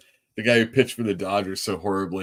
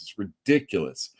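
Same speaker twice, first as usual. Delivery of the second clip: ordinary delivery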